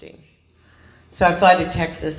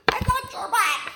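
mock high voice; 4 dB quieter than usual. Mock voice while freaking out.

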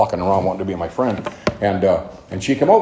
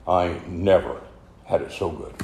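soft emotional echo; 4 semitones lower, 6 dB quieter than usual. Retold or imitated. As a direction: let the line fade softly. Repeated as closing thought.

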